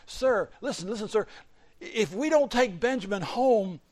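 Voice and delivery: urgent pleading. Acted. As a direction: beg him to listen. Judah pleads for Benjamin.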